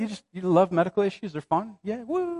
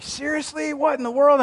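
disbelief; 10 semitones higher than usual. Retold or imitated. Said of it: "I was just like".

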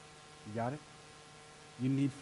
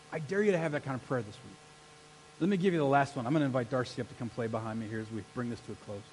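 normal talking voice

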